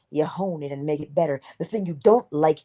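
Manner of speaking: ordinary talk, nothing marked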